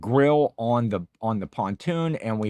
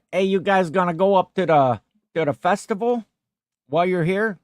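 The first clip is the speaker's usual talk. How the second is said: higher, louder imitation of an Italian-American cousin; Italian accent; 6 semitones higher, 4 dB louder than usual. Cousin asks visitors about the festival, Italian accent. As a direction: invite them along in an Italian-American accent. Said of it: "a real, like, you know, Italian accent".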